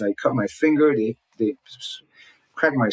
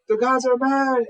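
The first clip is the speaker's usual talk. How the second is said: freaked out; 12 semitones higher than usual. Retold or imitated. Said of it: "we were just so freaked out".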